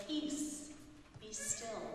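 soft, breathy hush of a command; calm the storm with a near whisper; quiet authority stilling the waves. Acted. Jesus calming the storm.

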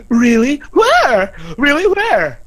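loud, high, eager questions; hammed-up eager surprise; gullible excitement. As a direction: jump on it like you've been fooled. Acts tricked character's eager reply.

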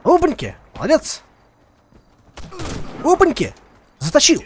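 loud high gleeful cheering; excited cheer. Real, not acted. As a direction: cheer the kill loudly. Cheering own kills in game.

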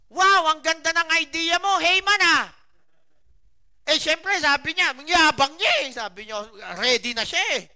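loud, high fiery sermon telling; preaching at full force. Acted. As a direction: drive the story home to the congregation. Preaches the Haman story loudly. (nangangaral nang buong lakas at malakas)

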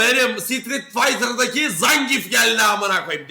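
loud, high, drawn-out hype; hyped shouting. Real, not acted. Streamer hailing a Street Fighter lookalike, hyped shouting. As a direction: shout it like a wrestler just entered the ring. Hyped reaction to a clip's figure.

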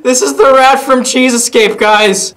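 loud, high video opening; excited intro. Real, not acted. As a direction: announce the game with loud excitement.

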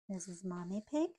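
ordinary delivery, nothing marked

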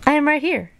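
high-pitched storybook frog voice; reassuring Frog. A narrator's reading. Frog answers Toad waking from his dream, high reassuring voice. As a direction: answer high to a worried friend. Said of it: "said Frog".

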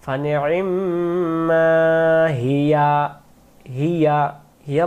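slow chanted recitation running on through Hiya; chanted recitation that joins ফানি'মা Hiya; recited without a breath. Shown as a demonstration. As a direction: carry ফানি'মা straight into Hiya without pausing. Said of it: "এভাবে করে পড়বেন".

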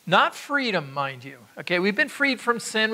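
ordinary delivery

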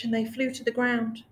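plain reading voice

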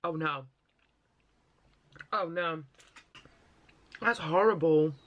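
puckered sour grimace; talking through covering hand; candy in mouth. Real, not acted. Seen 2: grimacing, mouth working on candy, hand over mouth from 3.2s.

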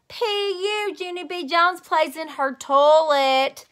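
high, loud, slow jeering singsong; mocking taunt. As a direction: tease her in front of the kids. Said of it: "That mean Jim laughed real loud".

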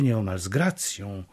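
usual speaking voice

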